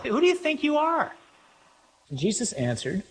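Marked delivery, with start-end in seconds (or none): 0.0-1.2 very high, incredulous challenge; indignant demand. Acted. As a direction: demand an answer with pitch soaring. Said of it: "They wanna know".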